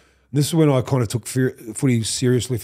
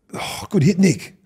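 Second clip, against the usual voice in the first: higher-pitched retold cheer; mock team praise, pitched up; imitating cheering teammates; 6 semitones higher, slower than usual. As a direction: call it out like an impressed teammate. Teammates cheer his tackle.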